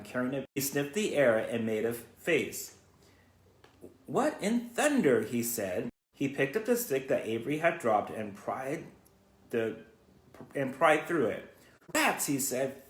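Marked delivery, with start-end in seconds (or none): 4.0-5.2 puzzled exclaim. Acted. Lurvy reacting to the smell in the pig pen, startled. 11.9-12.3 sharply high cry; disgusted exclaim. Acted. Farmhand discovers rats.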